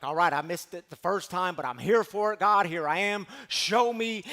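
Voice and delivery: eager calling. Retold or imitated. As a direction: demand the sign, ready for it. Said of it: "and Elijah's like".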